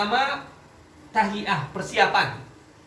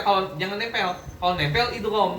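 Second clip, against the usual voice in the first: ordinary delivery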